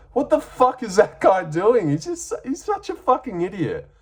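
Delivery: high-pitched exasperated rant; exasperated disbelief. Real, not acted. Fan ranting at a fighter's foolish move, exasperated. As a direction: swear in high, baffled exasperation.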